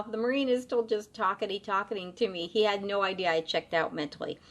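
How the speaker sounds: ordinary delivery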